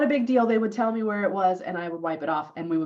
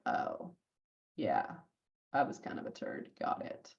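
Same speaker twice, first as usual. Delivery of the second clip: whispered, much softer, deflated; hushed, embarrassed concession; sheepish admission; 12 semitones lower, 12 dB quieter than usual. Retold or imitated. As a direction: mumble it like a caught teenager. Sheepish teen admits fault.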